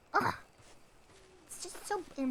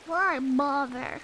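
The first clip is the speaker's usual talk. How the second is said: mock despair; 3 dB louder, slower than usual. Mock gloomy doomer lament.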